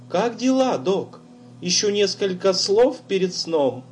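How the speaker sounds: put-on high, teasing girl on the phone; a ghostly girl's voice, playful; eerie girl's voice. A narrator's reading.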